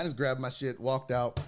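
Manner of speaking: ordinary talk, nothing marked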